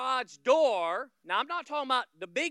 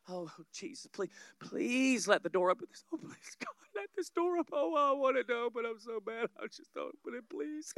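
hammed-up timid prayer, half-whispered; mock desperate pleading; 2 semitones higher than usual. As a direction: beg God like you doubt He'll come through. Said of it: "we're like".

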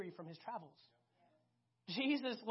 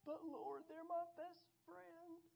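higher, slow, soft pleading believer; a softer, higher, childish protest to God; whiny protest; 7 semitones higher, 12 dB quieter, slower than usual. Acted. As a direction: plead with the Lord, higher and drawn out.

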